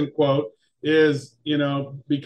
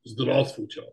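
Russian accent; 7 semitones lower, 5 dB quieter than usual. Shown as a demonstration. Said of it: "these accents are accurate".

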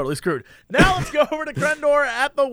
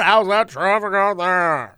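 mock anchor; 4 semitones lower, slower than usual. Anchor voice to reporter.

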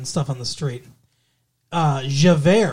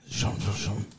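put-on French accent; 2 semitones lower, 5 dB quieter, slower than usual. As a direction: say it like a hammy Frenchman. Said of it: "that very French name".

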